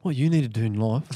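deep mock advice-giver; put-on voice. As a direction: drop the pitch into a comic character. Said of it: "That voice".

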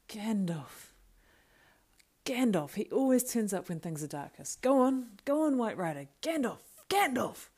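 high, loud cheering cries; wild shouting. Acted. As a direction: urge him on like a race spectator. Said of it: "He shouted wildly like an outlooker".

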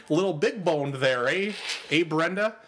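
slow mock Midwestern fuckboy accent; hammed-up drawn-out Midwestern accent. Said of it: "the Midwestern versions of those accents".

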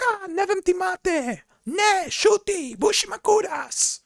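high, slow shrieking scold in Bosnian; angry Bosnian mom. Retold or imitated. Angry mother cussing out the boy who ran over her daughter, high shrieking scold. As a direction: screech at the kid like an outraged mother. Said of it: "Cussing me out".

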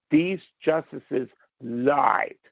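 lied hit hard, whole line very slow; slow it right down and punch lied; stress on lied. Real, not acted. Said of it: "I stress the word lied".

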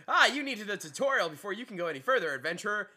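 game NPC. Pretend. Mock game NPC tutorial line.

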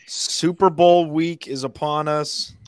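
announcer voice. Acted. Hyping the show opener.

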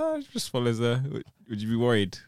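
ordinary talk

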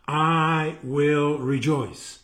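very slow, each word drawn out; slow, emphatic repeat. Shown as a demonstration. Preacher repeats the verse to listeners, very slow and emphatic. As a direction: stretch every word of the declaration.

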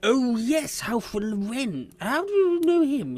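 high, plummeting exclamation; pompous surprise. Acted. Townmaster hearing of a mutual friend, surprised and high. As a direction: react with exaggerated, rising interest.

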